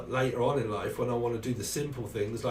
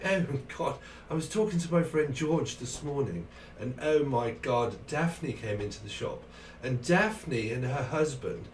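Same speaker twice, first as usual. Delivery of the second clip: higher-pitched, hammed-up posh English shop chatter; posh Cambridge accent; 3 semitones higher than usual. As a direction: play a very posh Cambridge gossip. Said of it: "they're very posh".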